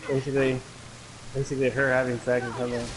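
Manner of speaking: ordinary talk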